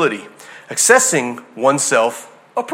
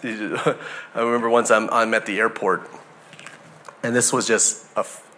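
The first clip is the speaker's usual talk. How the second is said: ordinary delivery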